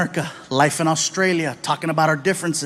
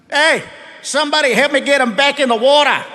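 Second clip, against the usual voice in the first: mocking beachgoer; 7 semitones higher than usual. Beachgoers mocking him, Aussie voice.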